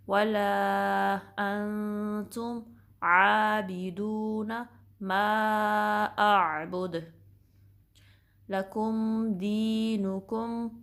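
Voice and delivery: slow, melodic recitation of the verse; Quran recitation. Shown as a demonstration. Teacher reciting verse five for students, slow and melodic. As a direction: chant it out, each word stretched. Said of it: "उसी तरह पढ़ेंगे जैसे मैंने".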